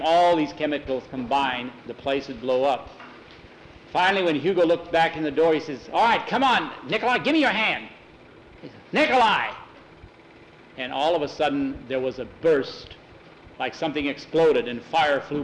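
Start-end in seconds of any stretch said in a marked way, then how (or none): 5.9-9.5 high, hurried shout for a friend; urgent calling. Acted. Hugo reaching for Nikolai, urgent calling. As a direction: call out urgently, pitch up.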